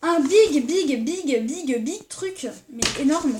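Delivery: big martelé, plus fort et plus haut; répéter big en insistant sur l'énormité; emphase excitée. Real, not acted. Exagère la taille du cadeau. (excitée, elle insiste sur big en montant la voix)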